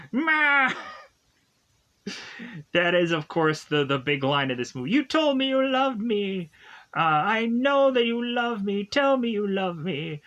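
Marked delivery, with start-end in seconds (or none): 0.1-0.7 high, hammed-up movie yell; one drawn-out high cry, imitating a yelling character; play-acted high-pitched holler; mock yell. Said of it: "[name] is yelling". 4.9-10.2 high-pitched mock yelling, easing down toward the end; [name]. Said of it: "[name] is yelling, like".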